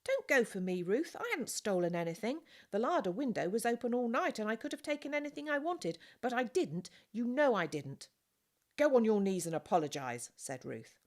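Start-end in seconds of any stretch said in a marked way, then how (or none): 0.0-8.1 higher, louder, indignant protest; boy protesting his innocence to his sister. A narrator's reading. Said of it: "began to protest". 8.8-10.3 louder stern order; commanding. A narrator's reading. Ruth ordering Jan.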